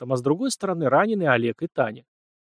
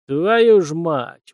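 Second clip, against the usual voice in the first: loud, drawn-out curse; slow, raised oath through clenched teeth; pained swearing; 4 semitones higher, 6 dB louder, much slower than usual. A narrator's reading. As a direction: curse slowly against the pain. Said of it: "выругался, стиснув зубы от боли".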